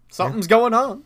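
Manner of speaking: put-on high, loud old-man quote; lightly voiced old man shrugging it off; matter-of-fact old man. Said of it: "matter of fact about it".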